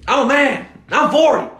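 high, loud mock yell; mock rant shout. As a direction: scream it like a furious coach at a press conference. Joins in the rant quote.

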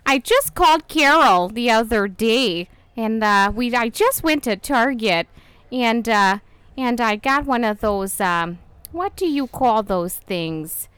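put-on loud, chatty Midwestern accent; big Midwestern character voice; Susan. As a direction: ramble on in hammed-up Minnesotan. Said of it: "a Midwestern character".

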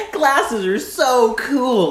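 put-on high, slow kid voice; childish voice. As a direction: gush like a little kid. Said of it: "and I was like".

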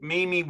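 two ee syllables in "meme"; meme as "mee-mee". Retold or imitated. Interviewer doubting a new pronunciation, "meme" as mee-mee.

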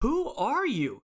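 high, slow bewildered question; baffled. Retold or imitated. Narrator baffled by the chip-eating stranger. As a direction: stare at her, totally thrown. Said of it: "And I'm like".

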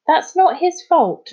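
high, loud, drawn-out protest; indignant storybook objection; objecting. A narrator's reading. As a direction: defend someone indignantly, slowly and emphatically. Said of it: "objected Jazz".

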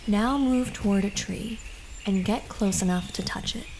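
usual speaking voice

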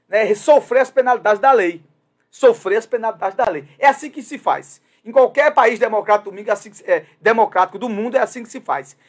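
loud, driving opinion rant, pressing every point; impassioned. Real, not acted. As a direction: hammer home the demand for justice. Discurso inflamado sobre a lei. (discurso inflamado e mais alto)

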